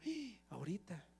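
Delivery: gasp, then barely voice the word; hushed alarm. Acted. Believer reacts to the enemy's threat, hushed alarm.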